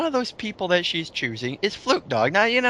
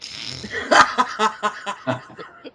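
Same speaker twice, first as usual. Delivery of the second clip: breathy laugh; 5 semitones higher than usual. Real, not acted. Breaking into laughter.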